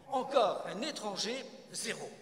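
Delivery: very loud, exasperated verdict; dismissive interruption. Acted. Nathan cutting off another foreigner, exasperated. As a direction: break in loudly and write him off. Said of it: "Nathan le coupe également".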